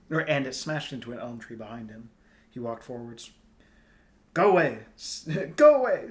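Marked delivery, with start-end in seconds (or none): 4.3-6.1 loud, high, raw tearful shout; raw and gulping. A narrator's reading. Star girl shouting at Tristan, raw and tearful. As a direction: shout through gulping sobs to drive him off. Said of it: "all raw and gulping".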